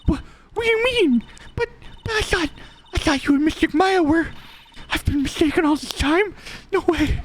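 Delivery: put-on high, breathless girlish disbelief; shocked young girl. Girl learning a secret, voiced high and stunned. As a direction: voice the girl reeling from the news.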